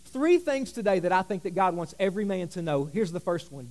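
usual speaking voice

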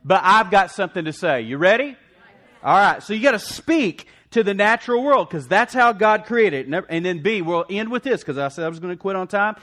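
loud, high, driving sermon delivery; full-force preaching. Real, not acted. Preacher rallying the congregation, loud and fervent. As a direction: preach it out, pounding each declaration.

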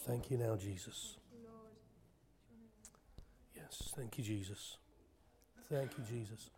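very soft, low murmured thanks; quiet, intimate thanks in prayer; hushed prayer. Real, not acted. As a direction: let the prayer sink almost to a whisper. Closes prayer softly.

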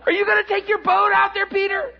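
high, loud, fast taunt; heckling disbelief. Acted. Buddies on shore to Peter, heckling loudly. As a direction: call out the question in disbelief.

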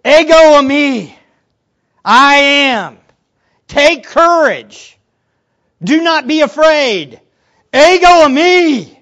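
loud, high, drawn-out proclamation; emphatic. Acted. Jesus to frightened disciples, emphatically proclaiming. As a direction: thunder each short phrase separately and slowly. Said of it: "This is emphatic".

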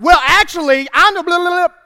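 high, loud mocking sass; sassy back talk. Son talking back to mom, sassy and high. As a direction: mouth off like a cheeky kid. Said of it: "it's more like".

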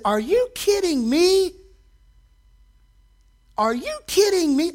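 exasperated disbelief. Retold or imitated. Preacher retells his outburst at the ads, incredulous. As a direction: throw up your hands in disbelief. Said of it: "I said".

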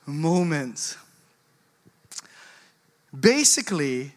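ordinary talk, nothing marked

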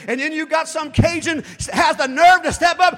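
loud, high, rising sermon shout; swelling pulpit cry; preaching at full force. Acted. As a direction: build the fire toward the quote.

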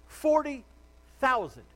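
"Forty" hit high and loud, then "thousand"; emphatic. Real, not acted. Pastor stressing the number to his congregation, emphatic. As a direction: punch "Forty thousand" slowly apart.

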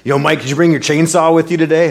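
put-on friendly Brooklyn-accented customer, up and quick; [name]'s Brooklyn accent. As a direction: belt it out like a cheerful Brooklyn guy. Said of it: "come out with this great Brooklyn accent".

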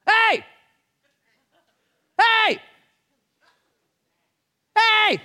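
high, loud single-word holler; attention-getting shout. Shown as a demonstration. Preacher demonstrating the Greek for crying out, attention shout. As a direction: shout to grab someone's attention. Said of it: "an ordinary shout to get attention".

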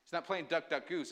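ordinary talk, nothing marked

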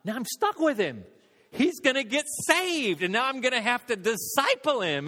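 lightly voiced dread at being stuck; dismayed inner thought. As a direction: sound trapped and put out at the prospect. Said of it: "I thought".

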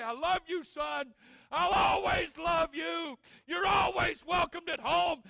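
loud, high, slow pleading mother. Retold or imitated. Mother to runaway son, loud and pleading. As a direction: cry it out like a loving mother. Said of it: "weep and sob and say".

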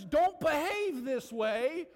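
slow, high, stern command; God's command. Retold or imitated. Preacher voicing God warning the church, slow and high. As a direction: draw it out slowly like God's warning. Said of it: "when God says".

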